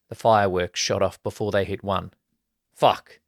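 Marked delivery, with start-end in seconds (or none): 2.7-3.2 higher and louder, caught off guard; startled exclamation. A narrator's reading. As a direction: blurt it out as the fireworks go early. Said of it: "Glenn exclaimed".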